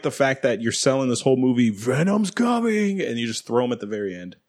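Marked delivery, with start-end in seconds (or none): 1.7-3.0 mock hype. Mocking the movie's marketing hype.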